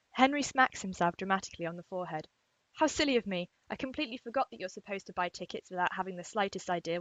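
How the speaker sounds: loud, animated fiction reading with lively dialogue; story read aloud. Acted. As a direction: read it out dramatically, voicing the quotes. Reads a fan fiction paragraph aloud.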